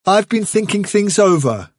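put-on young leader addressing the warren; Hazel's calm resolve. Hazel voiced in reading.

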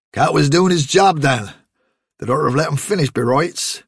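a farmer lecturing his daughter about rabbits; scolding farmer. Acted. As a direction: lay down the law. Father lecturing.

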